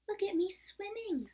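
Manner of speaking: squeaky Roo. A narrator's reading. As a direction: squeak it out proudly. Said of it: "squeaked Roo".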